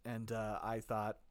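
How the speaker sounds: low, soft, understated storytelling; subdued confession. Real, not acted. Confessing a missed work potluck to co-host, subdued. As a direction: keep it hushed and low, setting up the punchline.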